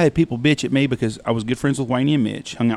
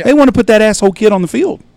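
heated; 8 semitones higher, 8 dB louder than usual. Real, not acted. Heated point to the listener.

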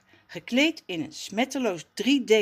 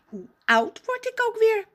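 put-on much higher, puzzled child voice; pondering boy; 5 dB louder than usual. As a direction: wonder aloud, puzzled. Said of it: "peinst hij".

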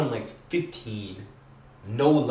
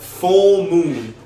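much louder, higher shout of Full moon; Full moon nearly shouted; emphatic repeat; 7 semitones higher, 13 dB louder than usual. Real, not acted. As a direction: hammer FULL even harder.

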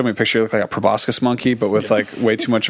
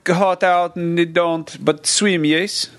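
mock Croatian; 7 semitones higher than usual. Mock Croatian broken English.

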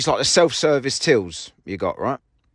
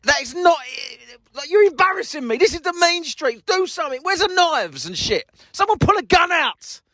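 higher, exasperated disbelief; egging them on in mock frustration; 11 semitones higher than usual. Real, not acted. Onlooker urging the squabblers on, exasperated and higher. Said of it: "cringing of embarrassment".

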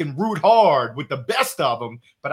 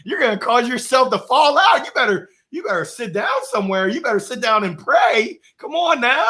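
mock scolding; 7 semitones higher than usual. Scolding the yelling coach.

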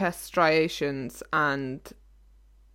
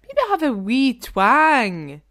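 put-on slow, louder playful Scottish accent, a bit higher; 5 semitones higher, 6 dB louder, slower than usual. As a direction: tease her with a mock Scottish lilt, slowly. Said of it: "When you put on my accent, you sound like you're from Shetland".

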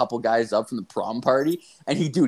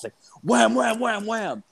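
high, punchy wham wham; mock punch sounds telling a fight; 10 semitones higher than usual. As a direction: pop each wham up high like a hit. Mimicking punches.

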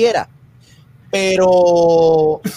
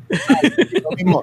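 normal talking voice

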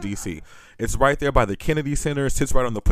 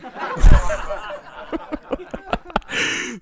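breathy high giggle, almost silent; laughing at his own joke on stage; high wheezing laugh. Real, not acted.

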